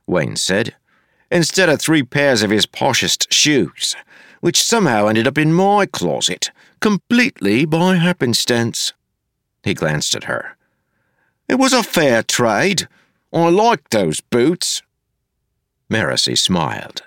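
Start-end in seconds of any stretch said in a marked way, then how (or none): 1.3-8.9 climbing higher, feigned innocent explanation; sly innocence. A narrator's reading. Wayne slyly explaining the shoe swap to Marcy. 11.5-14.8 defensive whine. A narrator's reading. Wayne petulantly justifying the trade to Marcy.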